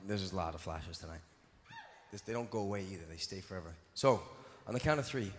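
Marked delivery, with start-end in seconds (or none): 0.1-3.7 softer, lower mock grumble. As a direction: mutter it low, half-annoyed. Comic low grumble about flashes.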